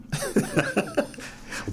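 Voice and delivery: a real laugh that leaps up high. Real, not acted. Laughing at how many relatives he meets, high laugh. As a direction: burst into a high, airy laugh.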